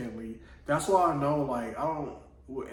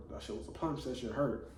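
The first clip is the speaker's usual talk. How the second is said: inner thought; 6 dB quieter than usual. Retold or imitated. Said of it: "back in the head, I'm like".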